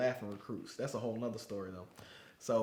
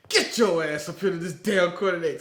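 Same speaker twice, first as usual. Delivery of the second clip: louder drill instructor order; mock drill instructor shout; 5 semitones higher, 10 dB louder than usual. Drill instructor orders McMillan forward, loud. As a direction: yell the recruit up front. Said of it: "He said".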